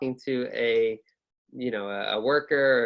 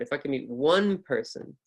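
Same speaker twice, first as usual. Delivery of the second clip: "one" stressed and held, other words light. Shown as a demonstration. Teacher marking the most important word, stress on "one". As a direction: lean on "one" in "one person". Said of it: "this word one is most important".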